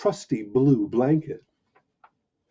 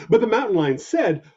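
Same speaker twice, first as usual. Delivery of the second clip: plain reading voice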